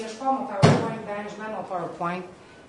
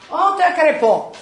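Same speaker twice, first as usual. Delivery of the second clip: emphatic exclamation; 8 semitones higher, 13 dB louder, slower than usual. Real, not acted. Punchline exclamation.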